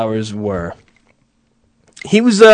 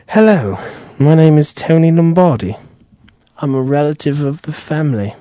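posh British accent, imitating Tony; mild British accent impression. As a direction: put on a mock British accent as the fake Brit. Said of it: "Perfect British accent".

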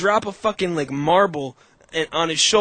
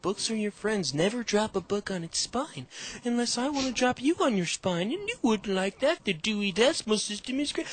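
softer, higher prim-librarian voice; calm librarian; 6 semitones higher, 4 dB quieter than usual. Acted. Librarian at the fights warning about books, very calm. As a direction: play a softly spoken, composed librarian. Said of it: "like, very calm".

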